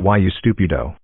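ordinary talk

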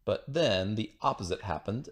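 ordinary delivery, nothing marked

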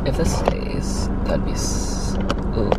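whispering to herself. Real, not acted. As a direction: hush it, slow and careful. Whispering while setting up.

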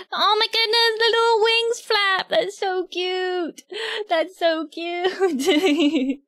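high squeal, slowing into a coo; gushing delight. Real, not acted. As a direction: melt over something adorable, stretching it out. Gushing over flapping little wings.

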